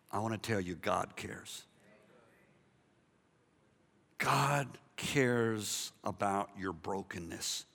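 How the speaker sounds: low, hushed, heartfelt assurance; slow down and soften to comfort them; tender reassurance. Real, not acted. Preacher telling broken people God cares, tender and soft.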